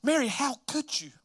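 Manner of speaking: high-pitched hurt reproach; acted dismay at being betrayed; upset. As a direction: play a wronged fiancé, voice lifted. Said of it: "I'm sure he's upset".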